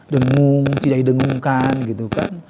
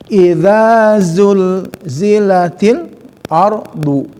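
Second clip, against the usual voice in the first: slow, drawn-out Quranic chant; recited verse; 4 semitones higher, 3 dB louder, much slower than usual. Shown as a demonstration. Teacher reciting verse slowly to students. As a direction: intone it slowly like tartil recitation.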